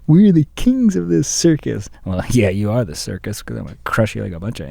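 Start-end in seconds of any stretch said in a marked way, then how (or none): 0.1-1.9 mock pompous boast.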